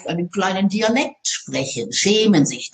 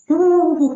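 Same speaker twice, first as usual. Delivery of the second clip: mock stage huffing; 8 semitones higher, 4 dB louder than usual. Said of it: "so Sachen machen auf der Bühne".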